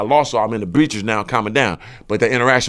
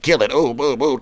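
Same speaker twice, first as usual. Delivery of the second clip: ordinary talk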